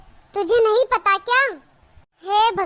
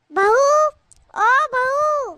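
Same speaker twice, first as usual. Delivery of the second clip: higher call across the house; 6 semitones higher, much slower than usual. Acted. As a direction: summon the daughter-in-law from afar. Mother-in-law calls out to daughter-in-law. (सास बहू को ऊँची आवाज़ में पुकारती है)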